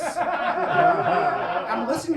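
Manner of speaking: loud, high, slow talk through laughter; laughing hard while talking. Real, not acted. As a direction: crack up while telling it.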